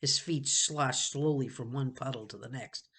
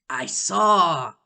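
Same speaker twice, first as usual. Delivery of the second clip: usual speaking voice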